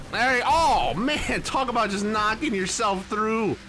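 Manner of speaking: sarcastic outburst. Real, not acted. Sarcastic praise after a wreck.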